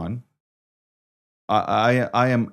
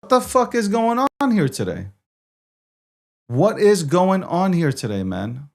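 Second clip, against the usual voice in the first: exasperated outburst; 5 semitones higher than usual. Real, not acted. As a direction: throw it at the broken stream. Exasperated at failing stream.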